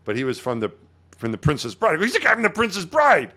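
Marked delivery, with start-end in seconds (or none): 2.0-3.3 high-pitched fan excitement, retold; excited. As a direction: gush as you recognize a film star. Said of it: "really excited about meeting this guy".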